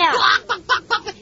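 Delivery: high, jeering hen clucks; teasing high-pitched clucking; mocking chicken clucks. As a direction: taunt with quick chicken noises. Bully mocks boy as chicken.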